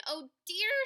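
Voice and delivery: high, fretful oh dear; lightly voiced anxious queen; worried queen. Queen hearing the princess floated away, worried. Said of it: "said the queen".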